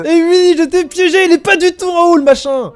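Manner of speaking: high, loud triumphant tease; gloating. Real, not acted. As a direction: crow about the trick. Gloating after tricking player. (triomphe moqueur, aigu et fort)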